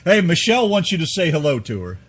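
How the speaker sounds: ordinary talk, nothing marked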